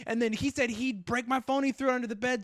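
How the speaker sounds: high-pitched imitation of an indignant ex. Ex confessing the attack to cops, mock-indignant. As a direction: play the ex pleading her case, pitched high.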